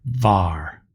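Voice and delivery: "Var" said clearly with a v and an open a; Hebrew syllable demo. Shown as a demonstration. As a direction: model "Var" for the class. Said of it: "Two examples".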